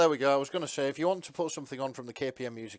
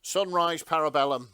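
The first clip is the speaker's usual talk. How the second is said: louder, higher, declared proudly; loud quote; 5 semitones higher, 9 dB louder than usual. Retold or imitated. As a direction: call it out like a motto. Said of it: "That was it".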